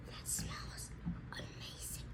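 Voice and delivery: hushed whisper over the drink. Real, not acted. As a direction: breathe it out in a whisper. Whispers while smelling the drink.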